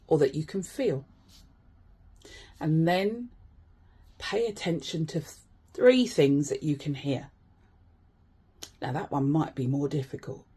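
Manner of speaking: calm grounding exercise. Acted. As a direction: talk them gently through each sense.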